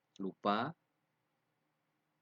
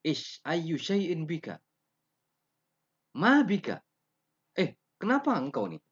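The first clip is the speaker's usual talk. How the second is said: loud, much higher, scornful challenge; rude to teacher; 10 semitones higher, 10 dB louder than usual. Shown as a demonstration. Student rudely questioning teacher in Arabic, loud and high. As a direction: talk down to the teacher. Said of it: "interaksi-interaksi seperti ini tidak pantas".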